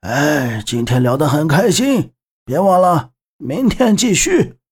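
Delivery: put-on cheery, regretful old drinker; tipsy old man. A narrator's reading. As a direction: say a fond, boozy goodbye as an old man. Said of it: "笑得像花儿一样的曾老头，但迷离的眼神中满是遗憾".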